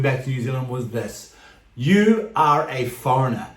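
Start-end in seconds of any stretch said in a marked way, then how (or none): none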